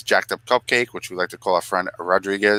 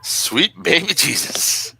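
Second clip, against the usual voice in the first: mock reverent exclamation; 8 semitones higher, slower than usual. Savoring reaction, drawn out.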